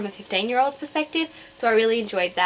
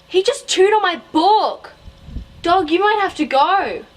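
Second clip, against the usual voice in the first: high, loud cry at the dog; exasperated outburst; 9 semitones higher, 6 dB louder than usual. Real, not acted. Vlogger interrupted as her dog chews the book, high and loud. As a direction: scold the dog half laughing.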